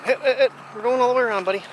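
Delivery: loud, high call to the dog. Real, not acted. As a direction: call out brightly to the pup. Calling to the dog on a walk.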